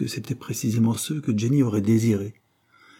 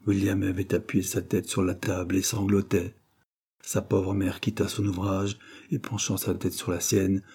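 plain reading voice

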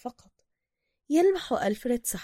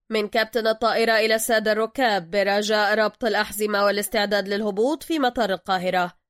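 loud captain over the cabin speaker; pilot announcement; 6 dB louder than usual. Acted. Captain tells passengers to fasten seatbelts, loud intercom voice.

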